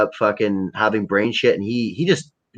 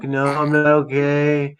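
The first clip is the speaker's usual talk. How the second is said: put-on slow, higher drunken whine; slurred drunk self; 4 semitones higher, slower than usual. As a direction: drag it out like a sick drunk. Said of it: "I'm like".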